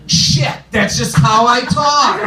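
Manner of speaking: loud, high exasperated outburst; yelling in denial. Acted. Comedian insisting to friends he isn't yelling, yelling. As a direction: shout it like your yelling mother. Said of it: "Why are you yelling?".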